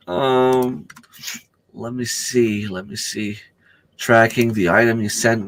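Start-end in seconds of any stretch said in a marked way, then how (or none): none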